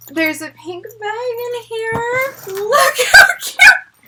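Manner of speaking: slow, high squeal of delight; overwhelmed delight. Real, not acted. Fan finding a pink bag in here, overwhelmed delight. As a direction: squeal it slowly, voice climbing.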